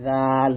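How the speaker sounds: জাল said light and slow; drawn-out thin জাল; thin zal. Shown as a demonstration. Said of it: "পাতলা করে".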